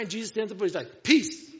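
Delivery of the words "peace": loud, high single-word command. Acted. Jesus standing up to the storm, loud command. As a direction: call it out over the storm.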